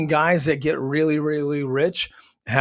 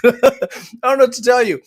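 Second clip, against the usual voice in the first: laughing; 9 semitones higher, 8 dB louder, much faster than usual. Real, not acted. Laughing at the gossip.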